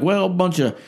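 high-pitched mocking retort; mock dismissive preacher. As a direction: imitate a preacher brushing off opponents. Mimics preacher's dismissive defence.

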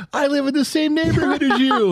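imitating a giddy neighbor, squeaky high; excited. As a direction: gush the news in a mock fan voice. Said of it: "He goes".

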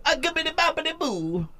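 very high, cartoonish Italian babble; mock Italian accent. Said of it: "descended from people that go".

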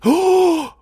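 high gasping loud whoa; stunned whoa. Retold or imitated. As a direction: gasp then cry out in shock. Crowd reaction, retold.

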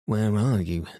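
ordinary delivery

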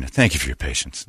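mock pleasant hotline voice; soothing phone rep. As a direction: imitate a rep soothing an angry caller. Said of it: "That's the one that gets me".

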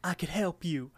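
high cartoon character voice; Promise Pie. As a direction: pipe up pleadingly as a talking pie. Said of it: "the pie's like".

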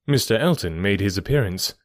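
ordinary delivery

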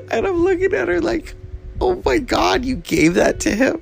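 squealing with laughter, very high; laughing disbelief. Retold or imitated. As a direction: laugh through a shocked oh my God. Retelling shocked reaction, laughing.

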